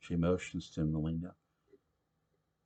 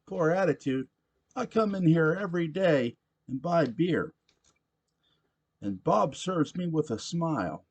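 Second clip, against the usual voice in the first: put-on loud, high rowdy drunk voice; a belligerent barfly demanding his beer; drunk pushy patron; 12 semitones higher, 6 dB louder than usual. Drunk patron Sam Evans voiced.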